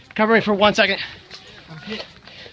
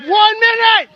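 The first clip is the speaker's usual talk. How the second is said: calling out; 7 semitones higher than usual. Real, not acted. Calling out time left.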